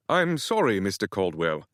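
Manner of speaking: a jumpy little British houseboy apologizing; light British accent, flustered. Acted. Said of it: "the man said in a British accent".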